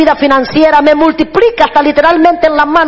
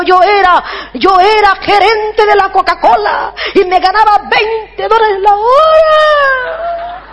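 mock crying; 5 semitones higher, slower than usual. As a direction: wail rising high and stretched out at the end. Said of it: "¿Por qué llora?".

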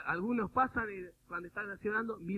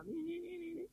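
high-pitched beeping little "ni ni"; mimicked meter noise; 10 semitones higher, 6 dB quieter than usual. Preacher mimics parapsychologists measuring the energy, high mock beeping. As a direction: imitate a gadget picking up energy, high.

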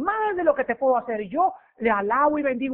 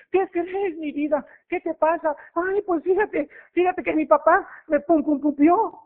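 put-on higher, sing-song girly voice; pitched-up teasing girlfriend; sweet girlfriend voice; 7 semitones higher than usual. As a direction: coo like a spoiled girlfriend.

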